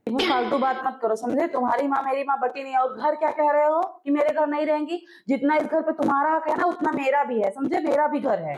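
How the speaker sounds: hushed scolding. Acted. Wife defending her mother's right to stay, hushed scolding. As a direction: tell him off under your breath.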